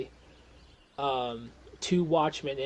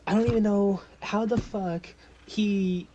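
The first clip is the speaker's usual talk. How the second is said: loud, higher exasperated detective; yelling; 7 semitones higher, 7 dB louder than usual. Acted. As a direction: rant at colleagues in frustration. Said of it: "he's yelling at the pixies".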